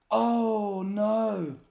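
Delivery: real dismay with a gasp. Real, not acted. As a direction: caught out by her own mistake. Realises a mistake in the game.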